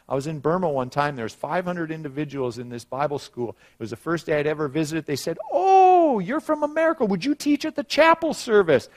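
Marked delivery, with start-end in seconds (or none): 5.5-8.9 very high, gushing mock excitement; eager hosts imitated. Bible school hosts inviting the visiting American to teach, eagerly imitated. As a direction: pipe up with an excited invitation, far above your own pitch.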